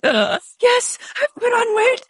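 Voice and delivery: high-pitched hammed-up tearful wail; gasp, then squeak it out as if about to cry; mock near tears. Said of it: "I'm, like, on the verge of tears".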